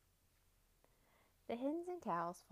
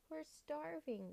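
whining; 7 semitones higher, slower than usual. Acted. Hungry animals complain.

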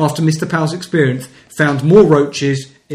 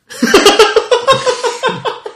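a sudden high laugh bursts out; high burst of laughter; 5 dB louder than usual. Real, not acted. Host hearing the bug excuse, laughing high and loud. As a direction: crack up at the quote.

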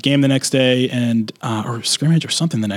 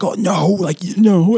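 mock outrage; 7 semitones higher, slower than usual. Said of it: "people would get pissed off".

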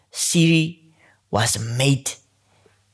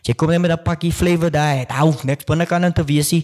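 usual speaking voice

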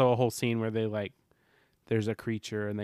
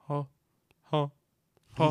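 mimicking music; 3 semitones higher, 4 dB louder than usual. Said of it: "is that the music like".

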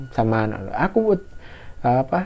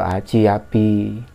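normal talking voice

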